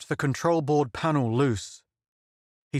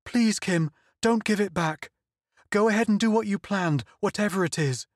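high, sobbing desperate plea; begging through tears; tearful pleading; 6 semitones higher than usual. Acted. Donna begs Kim to kill her rather than give up, tearful high plea. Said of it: "She sobbed again".

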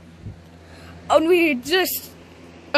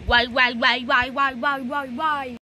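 mock wailing. Expelled kid wailing.

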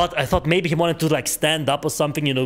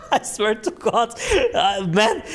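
laughing, higher-pitched swearing; real laughing fit; 8 semitones higher than usual. Real, not acted. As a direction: protest it through real laughter. Cracks up telling the story.

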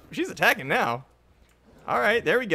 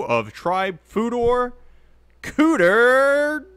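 booming ring announcer, drawn out; grand entrance announcement; 2 semitones higher, 3 dB louder, slower than usual. Pretend. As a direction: stretch out the names like a boxing announcer. Ring announcer introducing main event.

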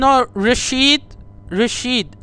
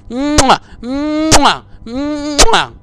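kiss sounds; 2 semitones higher, much slower than usual. Acted. Caller blowing kisses.

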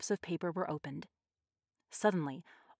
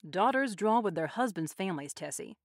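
ordinary delivery, nothing marked

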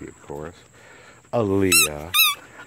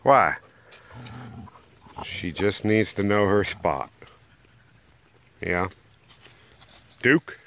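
usual speaking voice

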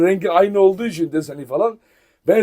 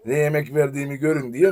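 ordinary delivery

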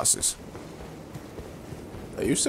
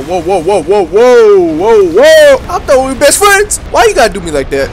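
building to a loud, high yell; betrayed and panicking, getting louder; alarmed whoa; 7 semitones higher, 12 dB louder than usual. Real, not acted. Gamer suddenly attacked by his wolf friend, building panicked yell. Former ally turns on him.

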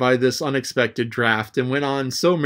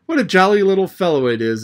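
exclaiming; 6 semitones higher, 4 dB louder, faster than usual. A narrator's reading. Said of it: "exclaimed he".

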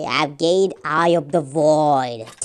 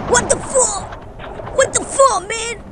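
shocked outburst; slower than usual. Real, not acted. Sees only fifty-four players left.

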